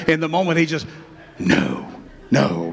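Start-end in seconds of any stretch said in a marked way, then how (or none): 1.4-2.7 low refusal. Acted. Peter refusing in the moment.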